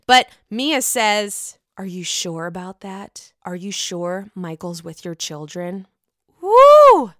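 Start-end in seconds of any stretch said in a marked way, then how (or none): 1.8-5.9 low, quiet, skeptical questioning; pointed doubt. Retold or imitated. Voicing Mia's pointed doubting question. 6.4-7.1 high, loud "woo"; loud whoop. Real, not acted. Reacts to the retold jab.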